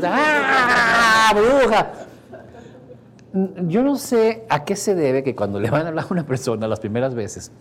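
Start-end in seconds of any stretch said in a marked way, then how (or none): 0.0-1.9 high, loud shriek of fright; startled scream. Retold or imitated. Husband wakes terrified, screaming at his wife. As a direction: jolt awake and yell at her.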